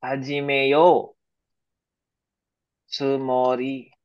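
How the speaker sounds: each syllable drawn out slowly for students; careful slow teacher demo; slow model phrase. Shown as a demonstration. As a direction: spell out "Hajimeyo tsumori" slowly, syllable by syllable. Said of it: "Mali, mali".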